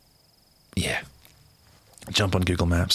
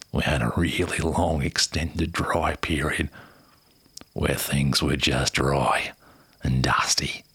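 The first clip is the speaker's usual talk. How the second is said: put-on dramatic Aussie accent, lower and slower; Australian accent; 4 semitones lower than usual. Quoting the operations manager for viewers, dramatic Aussie accent. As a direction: ham up the outback Aussie bloke. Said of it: "flick back into that Australian accent".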